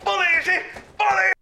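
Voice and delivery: very high shout; calling out. Acted. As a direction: shout for the police. Calls to police arriving.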